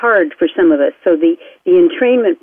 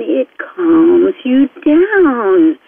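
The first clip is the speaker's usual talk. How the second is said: very slow, stretched words; long, lingering soothing delivery; drawn-out calming; 2 semitones higher, much slower than usual. Shown as a demonstration. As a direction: stretch it out as if winding down. Acting out being calmed down.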